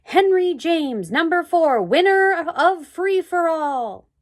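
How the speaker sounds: slow, loud announcer read; announcement. A narrator's reading. Lightly voiced announcer proclaiming the race winner, slow and loud. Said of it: "the big sign said".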